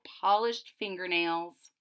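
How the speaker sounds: ordinary delivery, nothing marked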